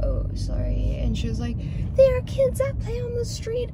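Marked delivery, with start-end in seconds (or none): 0.0-1.0 low-pitched mock "sorry"; retold apology. Quoting own answer to the scolding lady, low apology. 1.9-3.7 high-pitched scolding impression, no louder; mock yelling woman. Mimicking the lady who yelled over a stop sign, mock scolding. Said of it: "yelling at me".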